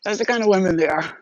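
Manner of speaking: ordinary delivery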